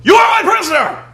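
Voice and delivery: very high, loud screaming turn; mock sudden yell. Elder suddenly seizing the Avatar, hammed-up shout. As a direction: snap from calm into a furious yell. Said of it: "the guy goes".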